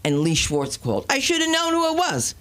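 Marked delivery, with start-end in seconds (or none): 1.1-2.3 high squealed punchline; high teasing squeal at Lee; mock squeal. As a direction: squeal the joke. Punchline jab.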